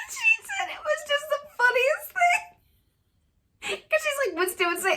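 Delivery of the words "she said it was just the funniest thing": high, wheezing laughter through the words; laughter close to tears; laughing. Real, not acted. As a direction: fall apart laughing as you say it. Laughing retelling friend's story.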